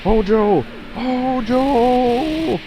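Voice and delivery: high, drawn-out cry; calling out. Acted. Crying out to Hojo, high drawn-out call. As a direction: hold the name long and high.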